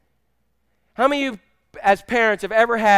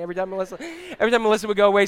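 normal talking voice

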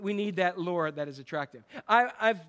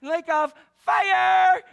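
much higher, louder, very slow and drawn out; mock hellfire shout; 12 semitones higher, 10 dB louder, much slower than usual. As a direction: parody a fire-and-brimstone preacher. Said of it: "someone who said".

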